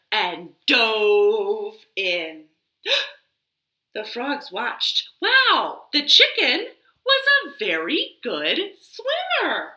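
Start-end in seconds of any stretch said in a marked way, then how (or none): none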